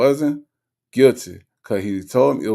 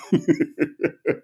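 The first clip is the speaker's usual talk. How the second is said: short high giggle; high, breaking-up chuckle; real chuckle; 4 semitones higher than usual. Real, not acted. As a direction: let out a quick giggle. Laughing at own foolishness.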